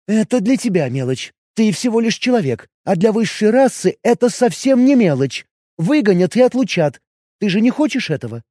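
jealous elf questioning her man; elf woman voice. Acted.

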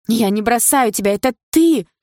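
high, loud accusation; upset girlfriend's high outburst; outraged protest. Acted. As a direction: throw the blame back loudly.

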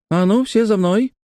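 put-on high, commanding child voice; bossy little girl. A narrator's reading. Girl through the bars orders all to follow, high bossy voice. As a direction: call them on as an eager small girl. Лекси зовёт всех за собой.

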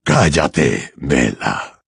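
low, cold order; Voldemort, cruel command. A narrator's reading. Voldemort silencing Bellatrix, cruel command. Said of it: "le ordenó Voldemort con crueldad".

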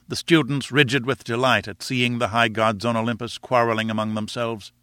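ordinary delivery, nothing marked

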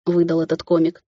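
usual speaking voice

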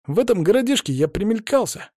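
put-on high boyish pleading; plaintive boy. A narrator's reading. Boy begs the man to take him, plaintive. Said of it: "жалобно посмотрел на меня мальчишка".

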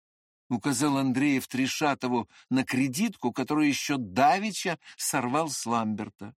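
ordinary delivery, nothing marked

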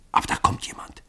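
urgent hushed whisper. A narrator's reading. Hiding by the garden, whispering a warning to Hall. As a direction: breathe it so nobody hears. Spotting someone approach in hiding.

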